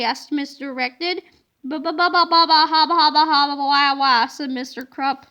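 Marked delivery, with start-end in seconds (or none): 1.6-4.3 louder babbling nonsense. A narrator's reading. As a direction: blurt gibberish in a stupor.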